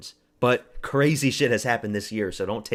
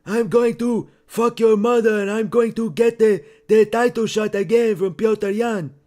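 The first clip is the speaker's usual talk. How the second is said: Merab impression; 13 semitones higher, 11 dB louder than usual. Doing Merab for his stream chat, a high loud brash callout. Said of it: "I can do a Merab impression".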